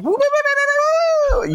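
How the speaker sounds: sung high woo woo mimicking a guitar; imitated whammy bar guitar. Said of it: "So it's like".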